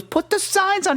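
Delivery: hammed-up nagging Italian mom; Jersey mom. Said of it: "She goes".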